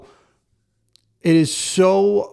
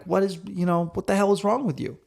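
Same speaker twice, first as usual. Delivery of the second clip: frustrated snap; 5 semitones higher, 3 dB quieter than usual. Retold or imitated. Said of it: "that moment of being like".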